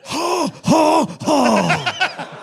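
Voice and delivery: spoken "ha, ha, ha", high start stepping down; separate, deliberate mock laughs; Clint Eastwood laugh. Host imagining a movie star laughing, deliberate stepped mock laugh. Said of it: "probably how Clint Eastwood laughs".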